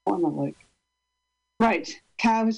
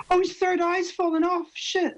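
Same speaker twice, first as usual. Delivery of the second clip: high startled yelp of dismay; surprised dismay; 9 semitones higher than usual. Real, not acted. Poet finds her cow prop broken, high surprised dismay. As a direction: caught off guard as the prop falls apart. Prop breaks before reading.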